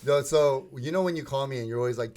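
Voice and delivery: usual speaking voice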